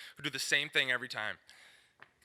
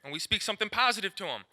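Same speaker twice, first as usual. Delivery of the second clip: normal talking voice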